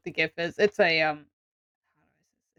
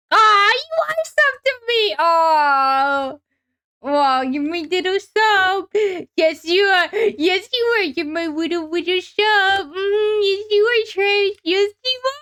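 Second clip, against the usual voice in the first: put-on high, slow cooing baby talk; 10 semitones higher, 5 dB louder, slower than usual. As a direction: coo at them like a baby. Baby-talk to a pet or viewer.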